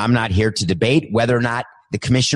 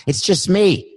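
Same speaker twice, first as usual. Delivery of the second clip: lightly mocking, higher pitched self-excuse; mock selfish voice; 7 semitones higher than usual. Host mocking listeners who think they don't matter, higher pitched and mocking. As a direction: say it like a shrugging freeloader. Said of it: "But if everyone says".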